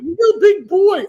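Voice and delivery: high, slow, loud holler; calling out. Real, not acted. Panelist trying to reach Real Big Boy, hollering. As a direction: holler the nickname, stretching every word.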